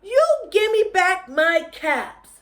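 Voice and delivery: high, loud demand; angry demand. Acted. Peddler demands his caps from the monkeys, loudly. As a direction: order them to give the caps back.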